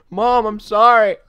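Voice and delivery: loud, high, drawn-out wail; hammed-up pleading kid; apologetic son. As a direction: cry out a panicked mock apology to mom. Son answers his screaming mom.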